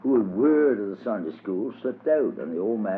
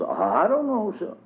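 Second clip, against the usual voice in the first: put-on higher childish voice, innocent; child's voice, much higher; little boy; 12 semitones higher than usual. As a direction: pipe up like a small boy dodging the question. Child answers the teacher.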